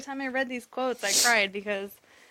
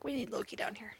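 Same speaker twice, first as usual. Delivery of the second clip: whisper; 12 dB quieter than usual. Retold or imitated. Hushed call in waiting room.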